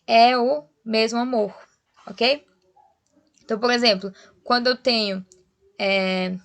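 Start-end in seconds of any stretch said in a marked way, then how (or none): 0.0-1.5 "é o" as one drawn-out beat, then pause; slow "é o... mesmo amor", with "é o" joined; joined sounds. Shown as a demonstration. Teacher counting poetic syllables, slow joined "é o". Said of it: "como um só som".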